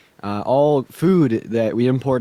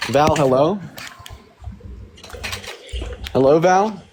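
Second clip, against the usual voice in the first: calling out; 4 semitones higher, slower than usual. Real, not acted. Calling out to a silent guest.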